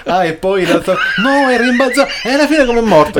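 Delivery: high giggly retold quote; laughing mock dialogue. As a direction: squeal it out through laughter.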